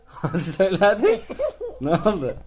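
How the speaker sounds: coaxing through laughter; laughing. Real, not acted. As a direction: giggle while you coax. Parent laughing while coaxing.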